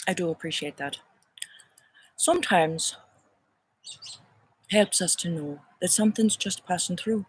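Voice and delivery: a light put-on Irish lilt; playful, lightly done Irish brogue; Irish accent. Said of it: "the accent that comes to me now is Irish".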